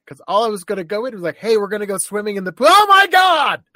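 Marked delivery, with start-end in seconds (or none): 1.4-2.7 breezy announcement. Retold or imitated. Bursting in, caught with magazines. 2.7-3.6 high, loud shocked shriek; shocked scream. Retold or imitated. Parent catches son with magazines.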